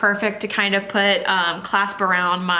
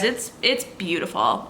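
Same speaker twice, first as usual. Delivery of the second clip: ordinary delivery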